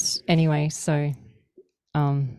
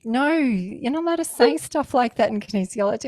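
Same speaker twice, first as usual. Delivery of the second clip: high, loud, amused telling-off; mock scolding; 8 semitones higher, 8 dB louder than usual. Practitioner tells off the joker, retold mock scolding. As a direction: forbid it loudly, pitched well up.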